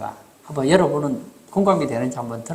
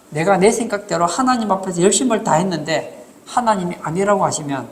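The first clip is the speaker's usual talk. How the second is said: usual speaking voice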